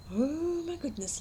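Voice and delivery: usual speaking voice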